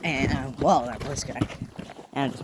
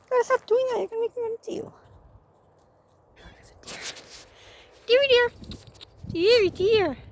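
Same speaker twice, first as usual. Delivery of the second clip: pet voice; 4 dB louder than usual. Real, not acted. To the meowing cat, very high squeaky mock scolding.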